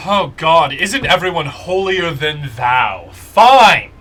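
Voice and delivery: scornful, building to a loud "Fine!"; exasperated Akene. Acted. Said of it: "She goes".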